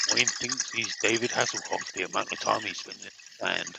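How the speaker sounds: low, hushed golf commentator; quiet golf coverage murmur. Pretend. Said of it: "You need golf announcer voices here".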